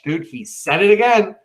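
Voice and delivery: high, incredulous aside; lightly hammed-up disbelief; mock amazement. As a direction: react as if you can't believe it. Mock reaction to the soothsayer's repeat.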